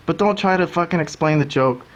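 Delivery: ordinary delivery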